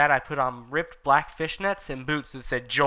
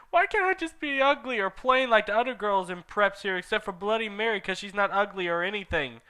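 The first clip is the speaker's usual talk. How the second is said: put-on whiny teen; 8 semitones higher than usual. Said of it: "I yielded".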